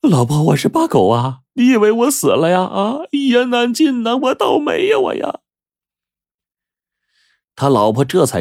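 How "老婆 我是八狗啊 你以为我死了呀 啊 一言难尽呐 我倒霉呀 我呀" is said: high, acted sobbing lament; tearful wail. A narrator's reading. Crippled husband tells wife who he is, crying. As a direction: wail your misfortune to her. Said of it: "他就哭着说".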